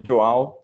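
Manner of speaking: "Joao" said as its owner accepts it; demonstrated pronunciation. Shown as a demonstration. Telling colleague what to call him, demonstrated pronunciation. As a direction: show how to say "Joao". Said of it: "tricky to pronounce".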